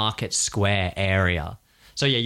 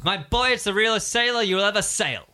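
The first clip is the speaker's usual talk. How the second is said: hearty recruiter; 8 semitones higher than usual. Acted. Recruiter answers player.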